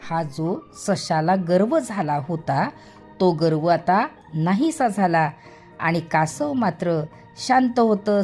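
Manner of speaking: plain reading voice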